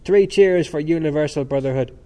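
loud, lifted cheer; crying out. A narrator's reading. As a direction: call it out to a crowd. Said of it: "responded to the close of the period by crying".

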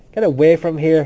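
loud, brusque order to leave; rude dismissal. A narrator's reading. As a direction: send them off rudely. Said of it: "he said rudely".

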